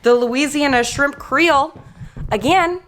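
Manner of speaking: loud, cheery outro; loud sign-off. Real, not acted. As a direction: sign off the vlog big and bright. Loud upbeat vlog sign-off.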